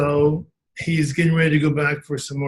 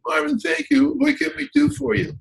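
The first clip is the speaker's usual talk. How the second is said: high, gushing voice of a thankful wife; imitating grateful wife; 11 semitones higher than usual. A grateful wife thanking Marv in a high gushing voice. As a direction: play the wife, voice lifted high with gratitude.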